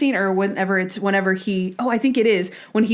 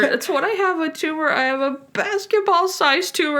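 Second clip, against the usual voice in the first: high-pitched, hammed-up desperation; exasperated outburst; 8 semitones higher than usual. Monk to the doctor about his nosebleed, mock-exasperated. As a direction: imitate a patient at the end of his rope.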